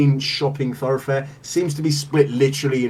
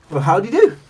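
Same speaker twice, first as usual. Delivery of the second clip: higher, louder cheery halfling greeting; friendly halfling; 9 semitones higher, 6 dB louder, much faster than usual. Acted. Halfling tinkerer greets the customer with a higher, louder cheery hello. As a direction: greet them brightly as a small halfling. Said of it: "she smiles".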